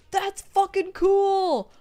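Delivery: loud, high, delighted yell at the fan art; thrilled shout. Real, not acted. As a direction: shout with pure joy, slowly.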